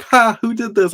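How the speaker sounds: high, loud, manic; hammed-up unhinged woman; crazy lady. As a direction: swing from scream to laughter. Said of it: "even the crazy lady".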